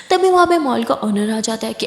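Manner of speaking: usual speaking voice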